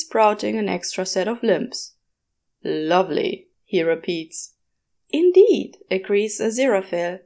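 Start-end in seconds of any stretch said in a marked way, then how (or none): 2.6-3.5 lower, dry deadpan repeat; Crawley's lower voice. A narrator's reading. Crawley flatly echoes the angel's praise. 5.1-5.8 high, loud eager agreement; eager Aziraphale. A narrator's reading. Aziraphale eagerly agrees with the demon.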